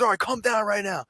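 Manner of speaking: high mock cop command; lightly voiced stern officer; cop's order. Streamer quoting the cop's command, high mock order.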